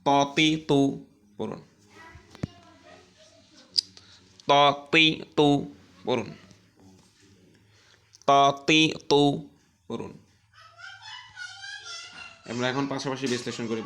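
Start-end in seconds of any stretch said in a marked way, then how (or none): 0.1-10.2 ততি তু said slowly with a heavy, thick t. Shown as a demonstration. Teacher showing students the heavy letter: thick t. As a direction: drag out ততি তু with a full, heavy t. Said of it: "মোটা করে পড়ুন।".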